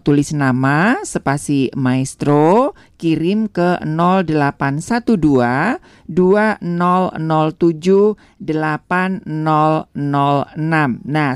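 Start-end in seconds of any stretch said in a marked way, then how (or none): 2.9-11.0 slow dictation. Shown as a demonstration. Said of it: "sekali lagi ya".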